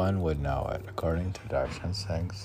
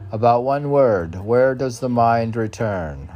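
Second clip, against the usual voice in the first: loud reading; 4 semitones higher, 7 dB louder than usual. Real, not acted. Reads the poem line loudly.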